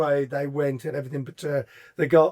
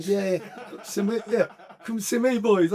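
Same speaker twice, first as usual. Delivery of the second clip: lightly put-on higher comic voice; hint of a comedian impression, pitched up; Peter Kay; 7 semitones higher, slower than usual. Footballer recalling his cocky welcome, lightly voiced comedian. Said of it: "I was like Peter Kay".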